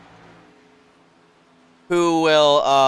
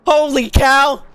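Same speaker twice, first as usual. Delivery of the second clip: loud amazed holy cow; excited disbelief; 6 semitones higher, 3 dB louder, slower than usual. Real, not acted. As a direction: exclaim in awe. Stunned by result.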